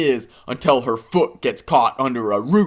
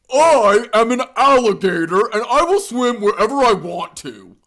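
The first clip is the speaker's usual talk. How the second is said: higher, stubborn character voice; cartoon alligator voice; 8 semitones higher than usual. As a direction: correct her like a proud little reptile.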